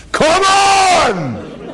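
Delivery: high, drawn-out "come on" wail; hammed-up exasperated yell; exasperated cry. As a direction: cry out in comic disbelief. Comic exasperation at hearing crying.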